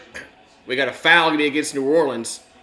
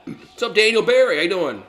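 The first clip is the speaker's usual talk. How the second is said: hiccups; 7 semitones higher, 6 dB louder than usual. Real, not acted. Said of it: "I got the hiccups".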